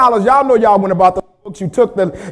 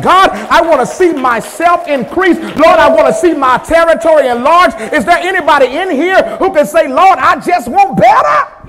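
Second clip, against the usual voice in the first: louder, higher, building toward "better"; rising preacher cry; 4 semitones higher, 5 dB louder than usual. Acted. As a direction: lift the room with each plea. Congregation prayer voiced.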